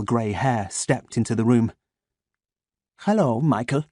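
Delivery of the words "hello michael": put-on soft German accent. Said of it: "in a soft voice, spiced with a German accent".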